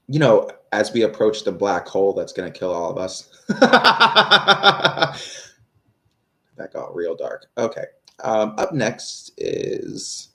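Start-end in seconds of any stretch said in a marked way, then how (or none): 3.5-5.4 long, loud, high cackling laugh; big laugh. Real, not acted. Streamer laughs at own dark joke, cackling loudly. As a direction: burst out laughing helplessly.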